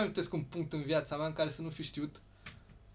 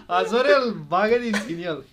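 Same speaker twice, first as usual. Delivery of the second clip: higher, louder, laughing through it; mock mother urging dog; 5 semitones higher, 13 dB louder than usual. Mother urges the dog Azorel to bite, laughing mock voice.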